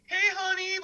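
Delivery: high sing-song catcall; imitating women calling out; mock catcall. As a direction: coo it like a street flirt.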